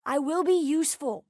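ordinary talk, nothing marked

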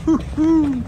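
ordinary talk, nothing marked

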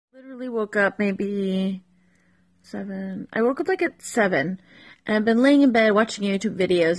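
ordinary talk, nothing marked

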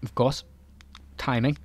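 usual speaking voice

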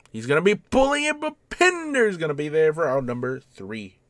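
excited live commentary, high-pitched; excited play call. Real, not acted. Gamer calling a fielder's catch for an out, excited. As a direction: shoot up high and quick on the call.

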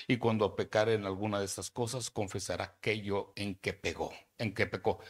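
loud, slow preaching voice; preacher reading Leviticus at full force; forceful scripture reading. Acted. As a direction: proclaim each clause to the hall.

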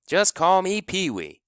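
high, loud swaggering boast; cocky kid. A narrator's reading. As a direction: brag like a cocky shortstop.

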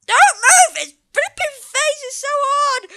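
put-on a boy bossing his dad around; child yelling. Son shouting at his dad mid-ride, a high kid's yell.

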